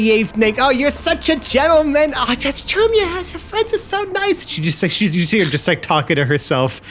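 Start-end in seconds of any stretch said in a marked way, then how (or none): 0.6-4.5 high, sugary compliments; high syrupy coo; sweet lady voice. Acted. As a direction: coo as she walks away. Gloria compliments as she leaves.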